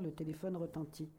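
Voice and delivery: usual speaking voice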